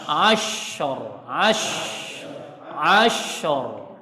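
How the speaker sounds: "ashor" with the sh flowing and the ending open; correct ashor. Shown as a demonstration. Teacher correcting the student's ashor. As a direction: draw out the sh in "ashor". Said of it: "Jangan ashor".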